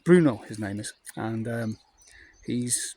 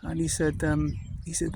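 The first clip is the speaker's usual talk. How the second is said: ordinary talk, nothing marked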